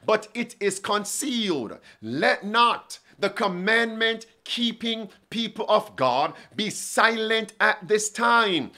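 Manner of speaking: ordinary talk, nothing marked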